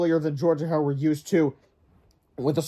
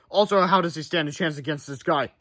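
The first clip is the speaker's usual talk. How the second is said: ordinary delivery, nothing marked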